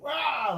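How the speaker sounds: huge high bellowed Ah; really loud Ah. Retold or imitated. Vlogger shows his snow-blasting yell, a huge bellowed Ah. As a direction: blast the snow away with a shout. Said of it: "like that really loud".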